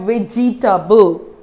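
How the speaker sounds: "vegetable" broken into ve-ji-ta-ble; four separate syllables in "vegetable"; wrong syllabifying. Shown as a demonstration. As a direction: sound every syllable of "vegetable", middle E included. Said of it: "don't just syllabify this saying".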